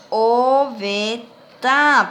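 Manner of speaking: very slow, every syllable stretched; careful pronunciation. Shown as a demonstration. Teacher dictates so sounds are respected, slow and careful. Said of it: "pronunciando bien y respetando sus sonidos".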